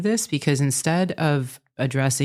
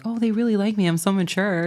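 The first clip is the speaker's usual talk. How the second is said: naive younger self; 6 semitones higher than usual. Retold or imitated. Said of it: "who I thought, like".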